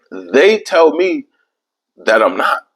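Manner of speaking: usual speaking voice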